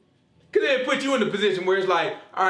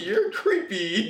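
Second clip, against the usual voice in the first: laughing tease; 6 semitones higher, much slower than usual. Acted. Joking about the stranger.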